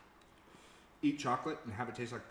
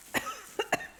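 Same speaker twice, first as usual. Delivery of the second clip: sharp choking cough; sudden cough; 3 dB louder than usual. Real, not acted. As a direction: cough from the spice. Spicy bite makes her cough.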